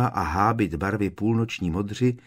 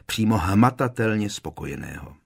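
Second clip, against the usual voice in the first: plain reading voice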